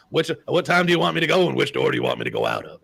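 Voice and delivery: imitating Ed Orgeron. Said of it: "He's like".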